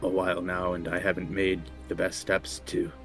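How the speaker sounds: reverent, hushed prayer. Acted. Player character praying to deity.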